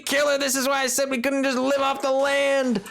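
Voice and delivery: high, exasperated calling out; exasperated yelling. Real, not acted. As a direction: yell it out in frustration.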